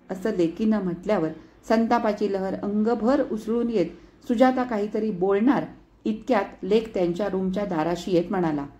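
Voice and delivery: usual speaking voice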